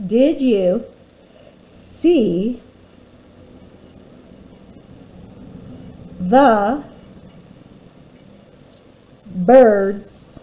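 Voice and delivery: each word slow and loud with long gaps; teacher dictating while the class writes; word-by-word dictation. Shown as a demonstration. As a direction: dictate one word at a time for writing. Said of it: "Let's go ahead and do that together".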